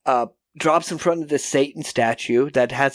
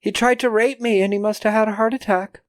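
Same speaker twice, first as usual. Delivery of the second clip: nonchalant; 7 semitones higher than usual. Retold or imitated. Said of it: "she's just so nonchalant".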